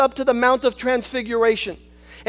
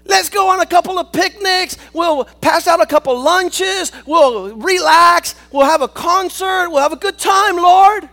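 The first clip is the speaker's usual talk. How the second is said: loud, high, eager Peter gushing; excited Peter; 6 semitones higher, 9 dB louder than usual. Acted. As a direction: shout it like a thrilled disciple with big plans. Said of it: "And Peter answered and said".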